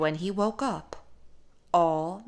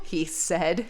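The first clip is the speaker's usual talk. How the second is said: usual speaking voice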